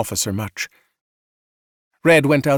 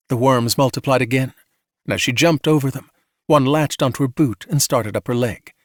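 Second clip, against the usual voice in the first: plain reading voice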